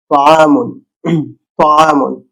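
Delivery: তাআমুন with a heavy ত from the throat. Shown as a demonstration. Teacher giving an example word with heavy ত. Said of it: "গলা থেকে ভারী করে বের হয়".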